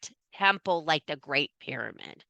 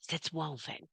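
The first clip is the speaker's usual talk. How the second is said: soft swoon; 13 dB quieter than usual. Shown as a demonstration. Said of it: "makes my little heart go".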